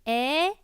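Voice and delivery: "eh" with a wide, horizontal mouth; loud, spread "eh" as the wrong example; horizontal mouth vowel. Shown as a demonstration. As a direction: say "eh" with the mouth stretched sideways. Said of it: "So instead of going".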